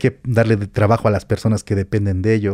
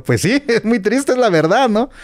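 quoted reply; 11 semitones higher than usual. Retold or imitated. Quoting himself to his grandfather.

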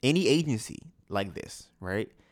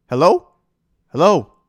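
loud, raised-pitch phone hello; calling out; 5 semitones higher, 11 dB louder than usual. Retold or imitated. Confused caller to a Spanish-speaking agent, calling out loudly. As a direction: call down the line to get attention.

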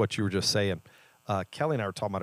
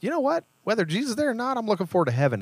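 much higher, a flippant put-on voice; mock indifferent believer; 14 semitones higher, much faster than usual. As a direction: toss it off like you don't care. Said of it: "Would we then just finish with".

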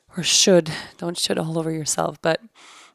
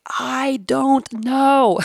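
slow, loud, higher inner voice declaring it; 7 semitones higher, 6 dB louder, much slower than usual. Retold or imitated. A voice speaking to her in prayer, loud slow declaration. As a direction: let the voice ring out slowly like a revelation. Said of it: "like a voice spoke to me".

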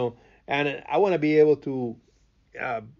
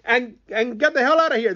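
high, loud fed-up customer; exasperated outburst; 13 semitones higher, 6 dB louder than usual. Acted. Customer yelling at the empty store, exasperated. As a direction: throw up your hands and yell it.

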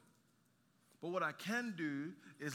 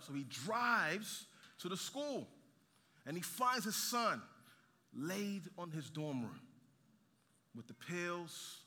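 ordinary talk

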